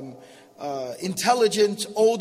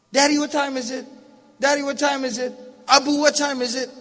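put-on higher, eager kid voice, lightly done; a light take on a small child pestering; little kid asking; 5 semitones higher, 4 dB louder than usual. Father imitating his little son asking the time, light kid voice.

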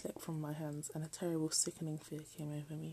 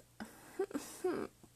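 breathy giggle; 12 semitones higher than usual. Real, not acted. Laughing at "boyfriends.

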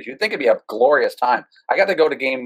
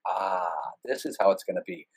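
quiet resignation; 4 semitones lower, 8 dB quieter than usual. Retold or imitated. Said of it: "I'm like".

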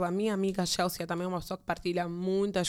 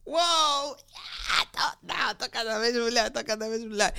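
delighted; 4 semitones higher, 4 dB louder than usual. Retold or imitated. Acting her own delighted reaction.

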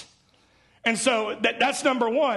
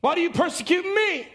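lightly voiced Jesus, higher and urgent; a hint of Jesus insisting from heaven, higher; raised voice of Jesus; 8 semitones higher than usual. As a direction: press the question, pitched higher. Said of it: "he heard a voice say to him".

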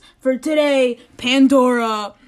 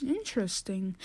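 dry suspicion; 7 semitones lower, 14 dB quieter than usual. Acted. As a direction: lower and much softer, pointed. Skeptical host.

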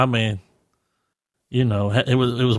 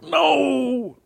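high, drawn-out "no"; mock dismay; 13 semitones higher than usual. Player retelling his reaction to a missed answer, mock dismay. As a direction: wail it high in mock despair. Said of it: "I was like".

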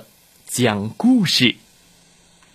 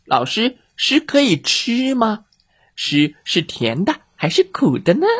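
chick voice; 9 semitones higher than usual. Acted. Chicks question dog teacher.

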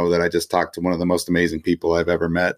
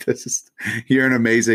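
laughing; 3 semitones higher than usual. Real, not acted. Praising host while laughing.

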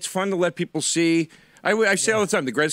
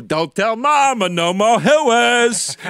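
retold comedian's punchline; 3 semitones higher, 5 dB louder than usual. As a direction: belt out the last words, half laughing. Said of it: "And he goes".

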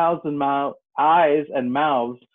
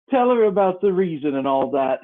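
Mock Turtle voice; 6 semitones higher, 6 dB louder than usual. Sleepy Mock Turtle orders Griffin.

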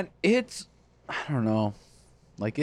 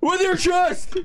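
high-pitched holler; playful raised shout; echoed shout; 13 semitones higher, much slower than usual. Acted. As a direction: yell it back as a catchphrase. Friend echoes the shouted catchphrase again.

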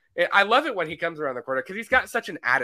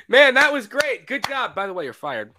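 high, loud false cheer then flat firing; fake praise; 4 semitones higher, 6 dB louder than usual. Director to actor, mock cheer then firing. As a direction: praise him and fire him in one breath.

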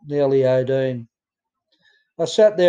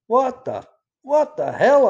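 livid disbelief; 11 semitones higher than usual. Retold or imitated. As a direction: pitch jumps far up in outraged shock. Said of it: "I was livid".

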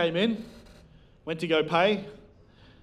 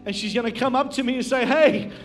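raised, urgent preacher's voice; building sermon delivery, pitched up; impassioned preaching; 5 semitones higher, 4 dB louder than usual. Real, not acted. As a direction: preach it with rising conviction. Preaches at full force.

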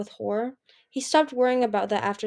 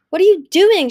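high, very loud shouted question; outraged shout; 10 semitones higher, 14 dB louder than usual. A narrator's reading. Moon shouting at Anemone under attack, full shout. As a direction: shout it in alarm. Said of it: "she shouted at Anemone".

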